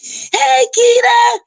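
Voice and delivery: squealed greeting. Real, not acted.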